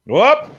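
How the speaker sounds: sharp rising whoop of surprise; excited whoop. Real, not acted. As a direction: yelp as the move lands. Reacting to a big move.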